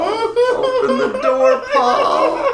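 hammed-up screech through the door; shrill shout. Wife yelling at locked bathroom door, mock screech. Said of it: "all I'd hear all night was".

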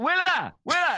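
high, loud shout of a name; mock-urgent high call. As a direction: hail someone down the street. Calling after Willa in street.

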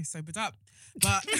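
ordinary delivery

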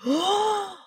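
high, re-enacted gasp of surprise; shocked gasp. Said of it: "I was shocked".

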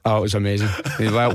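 normal talking voice

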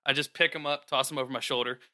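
ordinary talk

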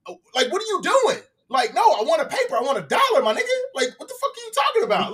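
hammed-up tourist fury at a vendor; going off. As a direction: demand the dollar in exasperation. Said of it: "going off on this dude".

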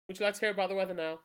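ordinary talk, nothing marked

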